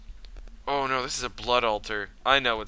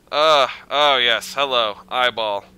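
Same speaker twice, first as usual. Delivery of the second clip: scared horror game streamer; 9 dB louder than usual. Real, not acted. Greeting a creepy eyeball in game.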